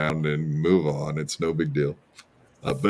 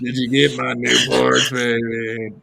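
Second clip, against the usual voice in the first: imitating her real voice; 3 semitones higher, slower than usual. Mimicking the woman's real voice.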